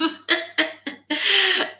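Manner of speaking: high real laugh; high amused laughter. Real, not acted. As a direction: giggle on. Laughing at invitation.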